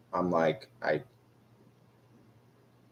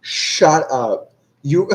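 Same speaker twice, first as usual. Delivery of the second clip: loud, higher-pitched incredulous burst; amused disbelief; 6 semitones higher, 6 dB louder, much slower than usual. Real, not acted. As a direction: blurt it out, about to crack up. Reacting in disbelief before cracking up.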